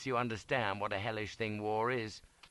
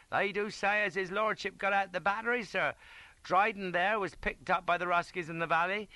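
high-pitched ranker's voice; piping soldier; 10 semitones higher, 4 dB louder than usual. A narrator's reading. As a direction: pipe up with news for the officer. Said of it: "pipes up one chap".

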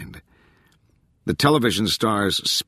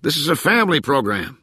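raised, high-pitched wisecrack; playful mock scolding, pitched up; joking yell; 6 semitones higher than usual. A narrator's reading. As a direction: call out the punchline to the room. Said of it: "someone yelled".

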